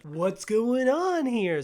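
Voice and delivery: high, slow, loud mock-ominous question; mock trailer mystery. Mocking the marketing campaign with a drawn-out, dramatic question. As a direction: ham up the trailer's big mystery. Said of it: "marketing campaign is like".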